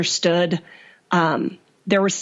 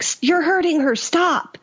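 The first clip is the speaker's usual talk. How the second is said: high-pitched cry of protest, little added volume; mock scream, voice jumping up high; retold scream; 9 semitones higher than usual. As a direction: imitate screaming at your husband to stop. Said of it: "I screamed at him".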